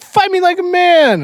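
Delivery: very high, loud imitation of a shouting kid; mock yelled challenge. Little Jackson repeats his sparring challenge, hammed-up yell. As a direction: holler it like an over-the-top little fighter.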